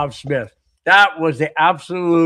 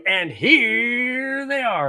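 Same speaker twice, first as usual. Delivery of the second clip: slow, drawn-out game show reveal; old-school announcer reveal; dating game host; 6 semitones higher, much slower than usual. Retold or imitated. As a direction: stretch it out like a TV host unveiling the ladies. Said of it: "they would bring out a guy and say".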